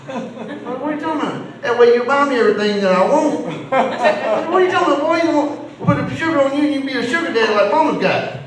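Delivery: high-pitched, hammed-up disbelief at his boy; incredulous father. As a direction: get loud and high, acting out a baffled dad. Father reacts to son's sugar list.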